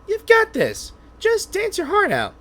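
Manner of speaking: cheering on. Acted. Sidekick cheering a nervous friend, high and bright. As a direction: pump her up with a high cheer.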